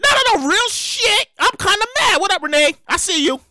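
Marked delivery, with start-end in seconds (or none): none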